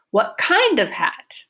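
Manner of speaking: long, high "kind", then falling away on the rest; stress on KIND. Shown as a demonstration. Teacher models the listener's question, stress on "kind". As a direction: stretch and lift "kind". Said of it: "Kind is my focus".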